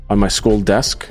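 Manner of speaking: ordinary delivery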